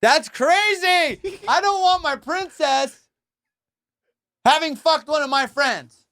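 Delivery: high, loud, drawn-out disbelief; shocked squeal. Real, not acted. To the host about a buddy and Meghan Markle, shocked high squeal. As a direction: squeal it out in mock horror.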